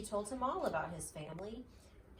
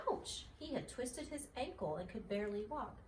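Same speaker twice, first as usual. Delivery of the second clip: usual speaking voice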